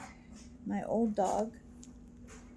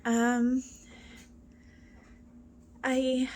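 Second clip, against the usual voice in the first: ordinary delivery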